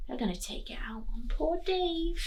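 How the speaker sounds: hushed, unvoiced aside; whispering to the class what's coming. Real, not acted. Teacher foreshadowing to children.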